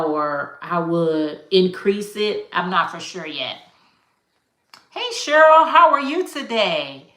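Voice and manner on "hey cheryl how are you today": high, upbeat hello to a viewer; excited live-chat greeting; bright greeting. Real, not acted. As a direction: call out a cheery hi.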